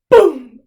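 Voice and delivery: very loud single boom; storyteller's goat butting the bear. Acted. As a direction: slam the word out.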